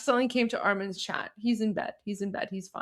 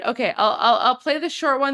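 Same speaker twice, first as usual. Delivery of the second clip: normal talking voice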